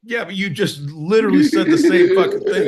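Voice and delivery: loud and high, half laughing in protest; exasperated outburst. Real, not acted. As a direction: blow up at him in mock outrage.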